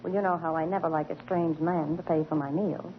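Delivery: normal talking voice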